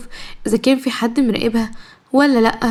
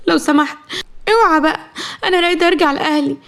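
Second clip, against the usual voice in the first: higher, pleading with a sob; crying; 5 semitones higher than usual. A narrator's reading. Captive young wife begging a man to let her go home, crying. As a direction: beg to go home through tears. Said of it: "زهرة بعياط".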